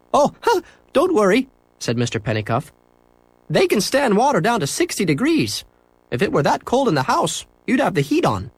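high, friendly shopkeeper; cheerful shopkeeper agreeing. A narrator's reading. Shopkeeper to boy in pet store, high and cheerful.

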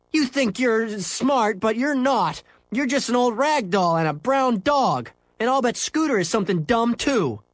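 high-pitched schoolboy comeback; yelling back. Acted. Henry mocking his friends' parts, high yelled retort. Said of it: "he yelled back".

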